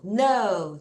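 ordinary delivery

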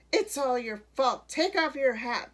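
accusing yell from a crowd; crowd shouting. A narrator's reading. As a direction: shout it at the culprit. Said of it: "the crowd shouted at Miss Honeycutt".

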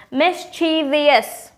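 "Mischievous" drawn out as mis-CHEE-vee-us; wrong four syllables. Shown as a demonstration. Teacher showing viewers the error, wrong four syllables. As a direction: slowly add an extra "ee" to "Mischievous". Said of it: "don't need to add the fourth syllable".

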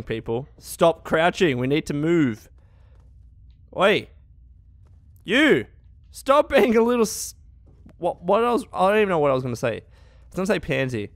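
normal talking voice

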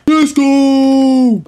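high, loud drawn-out cheer; triumphant cheer. Real, not acted. Gamer cheering after taming his dog, triumphant cheer. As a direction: celebrate the tamed dog.

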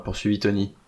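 ordinary delivery, nothing marked

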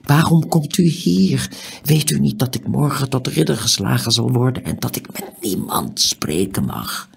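high-pitched agitated protest; agitated reproach. A narrator's reading. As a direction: reproach him urgently. Tiuri reproaches the stranger in secret.

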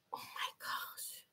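"Oh my gosh" whispered, slow and soft. Real, not acted. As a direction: breathe the words in mock shock. Shocked aside after a question.

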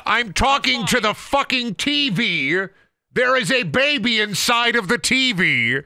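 high-pitched, incredulous mock rant; mock outrage. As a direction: pitch it way up, hammering out each word.

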